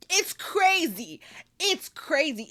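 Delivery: 1 disbelief. Real, not acted. Repeated exclamation, rising disbelief.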